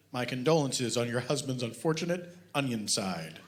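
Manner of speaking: deadpan reading of a mock detective novel; story reading. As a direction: read it dry, like a serious audiobook. Author reads his novel aloud.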